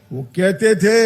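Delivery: normal talking voice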